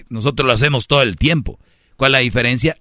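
normal talking voice

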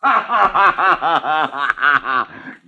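a hearty laugh as the ruse works; rustic booming laugh; triumphant laugh. Acted. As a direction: laugh at the end of the trick. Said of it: "and he's laughing".

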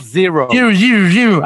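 zero zero zero in a low English accent. Shown as a demonstration. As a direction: say it like an American. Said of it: "En inglés se dice". (zero zero zero con acento inglés, grave)

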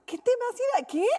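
mocking her daughter's plan; mom laughing in disbelief. Mom laughing at teen daughter's plan, amused disbelief. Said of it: "Mi mamá muerta de risa que dijo".

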